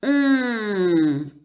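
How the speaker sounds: on held long, high and loud; nasal ON. Shown as a demonstration. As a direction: draw out on as one nasal vowel, no N. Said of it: "on prononce". (« on » nasal, fort et longuement tenu)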